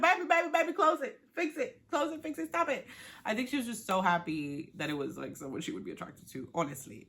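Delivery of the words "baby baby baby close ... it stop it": high, rapid-fire mock panic; frantic urging. Viewer urging a contestant to shut the door, frantic. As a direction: plead urgently in a high pitch.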